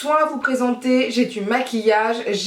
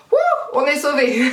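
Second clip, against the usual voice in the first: laughing with relief, a bit higher; relieved laugh; 5 semitones higher than usual. Real, not acted. Vlogger to viewers, relieved laughing over the label. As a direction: laugh it off, glad she was right after all. Relieved to find makeup remover listed.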